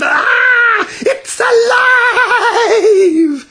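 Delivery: high, loud, drawn-out shriek; terrified scream. A narrator's reading. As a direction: scream in horror. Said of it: "The man screamed".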